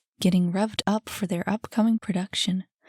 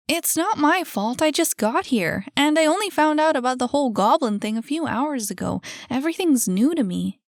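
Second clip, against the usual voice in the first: higher-pitched, defensive explanation; defensive protest; 6 semitones higher, 4 dB louder than usual. A narrator's reading. Annie tells Jackie she only just arrived, defensive. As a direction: plead innocence in a raised pitch.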